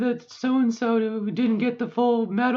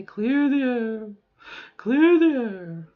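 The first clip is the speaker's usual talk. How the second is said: drawn-out, higher, louder glide; voice reset exercise; 3 semitones higher, 6 dB louder, slower than usual. Shown as a demonstration. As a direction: stretch CLEAR THE AIR slowly, lifting the pitch. Vocal exercise back to higher voice.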